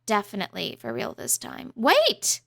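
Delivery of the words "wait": loud, high call to stop the door; the princess calling after her mom, loud. Acted. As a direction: shout it before the door shuts.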